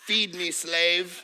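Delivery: slow, lordly cat demand; haughty cat. Acted. Cat on the windowsill bossing its owner, slow and haughty. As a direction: order your servant from the windowsill. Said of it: "it's sitting way up in a corner in some windowsill like".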